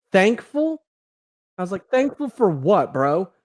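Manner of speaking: high, loud, slowed disbelief; incredulous echo. Retold or imitated. To the nurse, a high loud incredulous echo. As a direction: throw the word back in disbelief.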